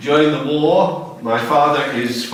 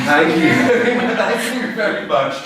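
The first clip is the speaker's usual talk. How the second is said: higher-pitched thanks with laughter; amused thanks; 8 semitones higher than usual. Real, not acted. Joking thanks amid class laughter.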